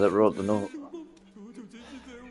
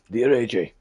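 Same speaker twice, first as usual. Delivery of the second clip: father's voice; 3 semitones higher, 6 dB louder than usual. Shown as a demonstration. Reading the father's letter aloud, a quick try at his voice. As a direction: test out how the father sounds. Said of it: "this is how he sounds".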